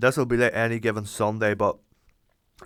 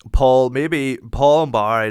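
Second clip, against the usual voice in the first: excited burst; 4 semitones higher, 9 dB louder, slower than usual. Real, not acted. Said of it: "excited about famous friends".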